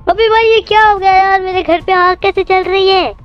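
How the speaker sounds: shocked outburst. Real, not acted. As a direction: yelp in disbelief as the house burns. House suddenly on fire.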